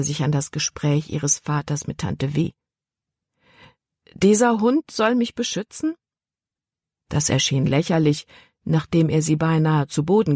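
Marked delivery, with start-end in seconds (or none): 4.1-6.0 put-on incredulous girl. A narrator's reading. Libby doubts the dog.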